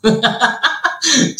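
a long, high delighted laugh; real laugh at his own scheme. Real, not acted. Laughs at selling hermit crabs.

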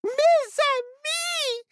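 put-on very high, slow squealing child voice; overjoyed little girl. Little girl greeting her new friends, squealing with joy. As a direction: bounce with pure joy. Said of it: "se réjouit-elle".

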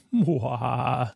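soft, friendly mock wail; a gentle, silly little cry; friendly little wah. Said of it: "like that voice, but that was a friendly voice".

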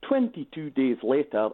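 usual speaking voice

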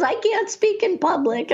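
high-pitched mock crying voice; imitating tearful client. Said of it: "she was crying".

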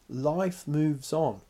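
slow, quiet and wise; slow moral. Shown as a demonstration. Comedian giving the audience the lesson, slow and soft. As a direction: drag out the moral slowly. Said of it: "that's the lesson, folks".

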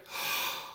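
quick unvoiced sharp gasp into the chest; chest gasp demo. Shown as a demonstration. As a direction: show a kid's shallow gulp of air. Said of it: "They do that".

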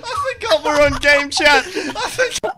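high, loud, helpless laughter; laughing in disbelief. Real, not acted. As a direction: gasp it out between laughs. Laughing after embarrassment.

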